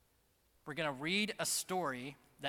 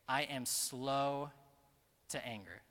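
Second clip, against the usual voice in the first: I am slow, the slow stretched and weighty; stress on slow; 2 semitones lower, slower than usual. Shown as a demonstration. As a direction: lean into the word slow, unhurried. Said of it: "he chooses to emphasize slow".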